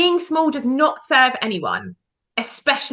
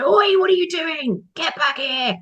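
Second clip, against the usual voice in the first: high, drawn-out "oi" telling-off; mock shout with stretched vowels; calling out; 7 semitones higher, slower than usual. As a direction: call off a misbehaving dog. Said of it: "We've never ha-, been able to go".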